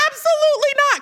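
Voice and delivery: high, loud retold reassurance; emphatic mock denial. Kamala reassures the host, emphatic mock denial. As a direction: imitate a firm, high-pitched denial.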